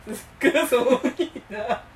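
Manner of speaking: asking through a real laugh. Real, not acted. Reacting to the friend's revolution joke, laughing.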